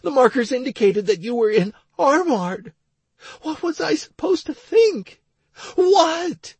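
tearful, high-pitched woman's voice; Stacy. A narrator's reading. Crying wife explaining her panic to her husband, tearful. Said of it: "still crying her eyes out".

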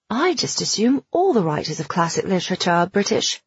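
put-on British accent. Girl showing off to a new acquaintance in a British accent. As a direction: say it in a showy English accent. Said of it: "in an English accent".

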